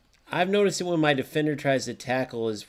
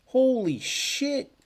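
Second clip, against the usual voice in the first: higher and stretched out slowly, awestruck; amazed exclamation; 11 semitones higher, much slower than usual. Real, not acted. Streamer awestruck at a wild play, drawn-out exclamation. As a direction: let the shock ring out long and high.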